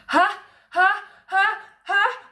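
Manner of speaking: high loud ha ha gathering force; a sneeze winding up; sneeze building. A narrator's reading. Sam's sneeze building in the throne room.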